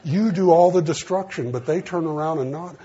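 the preacher crying out to his congregation; fervent preaching shout. Real, not acted. Preacher crying out to his congregation about grace, in a loud, high voice.

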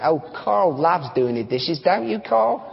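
put-on Australian accent, lilting up at the end; mock Aussie accent, rising on the name. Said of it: "say this with an Australian accent".

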